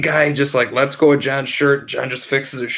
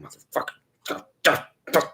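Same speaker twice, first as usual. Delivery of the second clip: muttering to himself; 3 semitones higher, 5 dB quieter, faster than usual. Retold or imitated. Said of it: "sitting in his room going".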